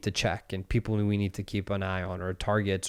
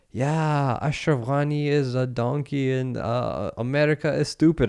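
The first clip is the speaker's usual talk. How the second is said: broken English; 5 semitones higher than usual. Retold or imitated. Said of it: "trying to speak English to me".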